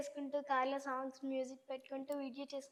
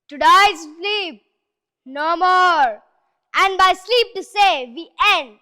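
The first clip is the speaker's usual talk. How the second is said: booming, very slow theatrical recitation, much louder; Prakash Raj monologue; 2 semitones higher, 17 dB louder, much slower than usual. Acted. Child actor performing Prakash Raj's Shakespeare speech, booming and slow. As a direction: declaim it like a veteran stage actor. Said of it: "Prakash Raj గారిది dialogue".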